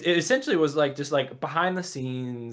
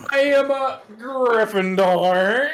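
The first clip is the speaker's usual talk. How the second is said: dramatic wizard voice; 11 semitones higher, 6 dB louder, slower than usual. Pretend. Wizard boast with wand.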